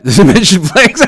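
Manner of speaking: high, laughing question; laughing while asking. Real, not acted. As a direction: break into laughter mid-question. Laughing at his own joke.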